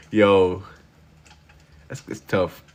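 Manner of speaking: ordinary talk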